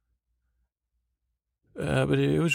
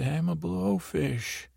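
higher and slower, meaning every word; with conviction; 5 semitones higher, slower than usual. Retold or imitated. Jesse, told to mean it, declares it with conviction. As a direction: own the line like you finally believe it. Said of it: "Say it like you mean it".

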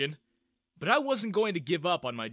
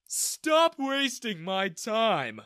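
yawning; 8 semitones higher, 6 dB louder, much slower than usual. A narrator's reading. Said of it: "he yawned".

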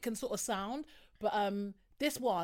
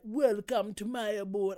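slow, badly faked Jamaican welcome; attempted Jamaican accent; slower than usual. Contestant welcoming dinner guests, slow botched Jamaican accent. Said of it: "trying to do a Jamaican accent".

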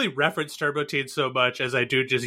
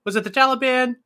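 confused guessing; 9 semitones higher than usual. Retold or imitated. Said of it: "I'm just like".

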